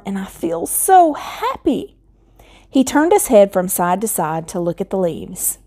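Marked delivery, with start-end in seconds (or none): 0.1-2.0 happy scarecrow. Acted.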